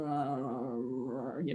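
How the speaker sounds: low, soft, muddled groan; drawn-out, foggy non-answer. Retold or imitated. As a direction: imitate someone too muddled to answer. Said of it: "she's totally muddled up".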